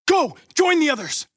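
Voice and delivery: high, loud order; commanding the androids to flee; urgent shout. Acted. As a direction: shout them away. Sending androids away.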